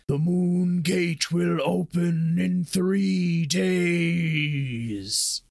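slow booming voice from the sky; giant alien booming voice. A narrator's reading. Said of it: "A booming voice echoes".

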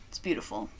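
whispered awe. Real, not acted. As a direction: breathe it out unvoiced. Whispered admiration of can.

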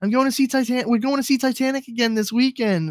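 high, gushing mock teenage-girl voice; imitated high-school girl. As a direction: imitate an excited girl chattering to her friends. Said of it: "And she was like".

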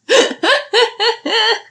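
high squealing laugh. Real, not acted. Laughing at own joke.

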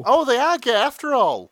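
high, loud mock astonishment; gleeful high cry of revelation; mock shocked exclaim. Real, not acted. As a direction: exclaim it like the big reveal.

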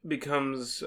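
ordinary talk, nothing marked

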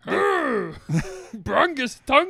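very slow, drawn-out mock Viking grunt; Viking accent. Shown as a demonstration. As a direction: drag it out like a cartoon Viking. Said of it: "What does a Viking sound like?".

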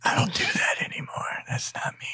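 soft, shy whisper. Real, not acted. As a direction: whisper it, half embarrassed. Whispering embarrassed refusal.

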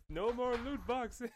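high, slow mock-royal decree; Queen Elizabeth. Friend playing the monarch banning loot boxes, high royal decree. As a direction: proclaim it slowly as a pretend monarch. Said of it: "Queen Elizabeth".

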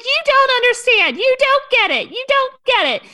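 high, loud meltdown imitation; hammed-up wail of someone misunderstood; mock yelling. Imitating Tamara yelling at Gina, high mock meltdown. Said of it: "started yelling and started yelling at Gina".

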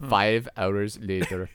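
low, slow time-card voice; drawn-out, deep narrator parody; mock narrator. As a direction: intone it like a time-skip caption. Mock time-skip caption after failed takes.

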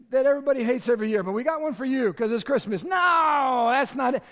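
usual speaking voice

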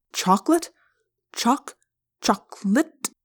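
"chocolate" broken up, then finished as a question; trying out the word. A narrator's reading. Alien tasting a new human word, trying it out. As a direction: sound out "chocolate" in pieces, with pauses. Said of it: "trying out the word".

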